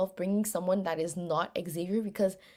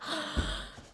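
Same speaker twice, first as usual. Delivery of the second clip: sharp breathless gasp; shocked gasp; 9 semitones higher, 5 dB quieter than usual. Retold or imitated. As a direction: gasp in disbelief. Reacting to Kai's words.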